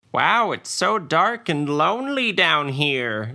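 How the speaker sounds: high, slow, loud little gnome voice; loud gnome bait. Acted. Gnome sorceress calls into the dark to lure undead, high and slow. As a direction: play the helpless little gnome to lure them. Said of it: "she exclaimed loudly".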